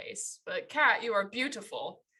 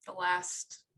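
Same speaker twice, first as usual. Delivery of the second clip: unenthusiastic; 2 semitones lower, 5 dB quieter than usual. Real, not acted. Co-host echoing that it is the last episode, unenthusiastic. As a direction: say it with no enthusiasm at all. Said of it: "You sound so enthusiastic".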